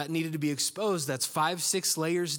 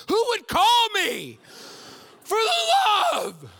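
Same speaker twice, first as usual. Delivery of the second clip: slow, loud, much higher outburst; exasperated shout; 9 dB louder, slower than usual. Retold or imitated. Driver yelling at his ringing phone, loud exasperated shout. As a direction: bellow it out in frustration. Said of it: "I'm going".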